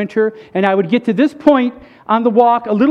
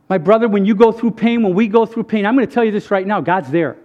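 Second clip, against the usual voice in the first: normal talking voice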